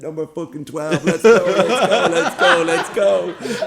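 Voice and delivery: high, loud marshal rushing people through; mock hurrying shout. Nurse hustling people through like jumpers, mock shout. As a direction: build to a hammed-up, laughing holler.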